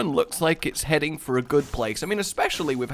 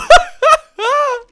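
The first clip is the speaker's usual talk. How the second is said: loud squealing laugh; high burst of laughter; 10 dB louder than usual. Real, not acted. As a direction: crack up at your own joke. Laughs at own bad impression.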